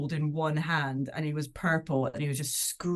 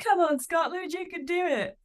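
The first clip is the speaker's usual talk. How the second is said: a much higher, eager cheer for Scotland; imitating husband cheering; 13 semitones higher, 3 dB louder than usual. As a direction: mimic a supportive husband cheering the team on. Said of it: "He's like".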